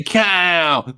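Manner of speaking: "Kyle!" shouted high and drawn so it sounds like "cow"; Kyle called out. Acted. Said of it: "when you say Kyle like that".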